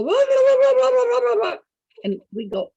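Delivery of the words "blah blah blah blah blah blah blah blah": high, loud, hammed-up preacher ranting; mock preaching shout. Imitating the host thumping his Bible and preaching at his guests. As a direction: pound out gibberish like a fiery preacher. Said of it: "and he'd go".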